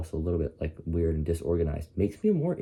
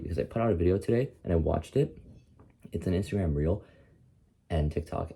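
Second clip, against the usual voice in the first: usual speaking voice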